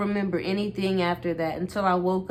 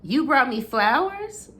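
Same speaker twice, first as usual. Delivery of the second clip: surprised; 7 semitones higher, 4 dB louder than usual. A narrator's reading. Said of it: "Hold on".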